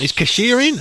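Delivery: high, loud larrikin bloke voice; imitating a bloke. A stranger mistaking the comedian for staff, high and loud. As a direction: ham up a dopey Aussie tradie. Guy asks again.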